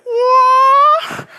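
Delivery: high, loud, drawn-out kung fu wail; kung fu cry. Retold or imitated. Preacher mimics the cat attacking the dog, kung fu cry. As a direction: imitate a martial-arts cat's battle cry. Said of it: "the kung fu noise".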